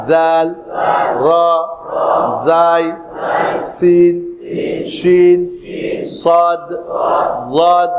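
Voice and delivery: drawn-out, relaxed letter names with long gaps; unforced letter recitation. Shown as a demonstration. Teacher modelling correct pronunciation for learners, easy measured recitation. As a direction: sound each letter steadily, nothing forced. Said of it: "কিছুই শক্ত করিবেন না".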